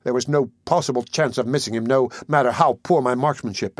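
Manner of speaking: ordinary delivery, nothing marked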